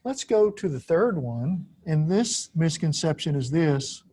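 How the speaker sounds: ordinary delivery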